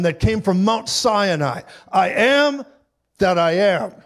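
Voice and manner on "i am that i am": booming declaration. Acted. Divine name from Mount Sinai.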